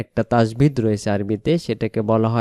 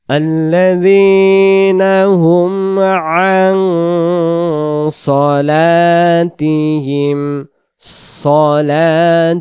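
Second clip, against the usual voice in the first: slow, drawn-out melodic chant; Quran recitation; 3 semitones higher, 6 dB louder, much slower than usual. Shown as a demonstration. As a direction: recite it slowly with long held vowels. Recites the verse again.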